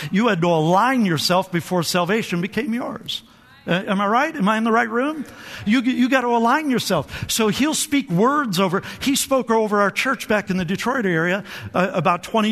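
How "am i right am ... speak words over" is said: usual speaking voice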